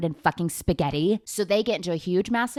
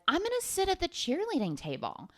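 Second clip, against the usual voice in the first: high, airy mock cheerleader voice; mock girly cheerleader; 11 semitones higher, 4 dB quieter than usual. Sasha announcing her new table, in a high mock voice. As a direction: lift it up high like a proud popular girl. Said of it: "And she's like".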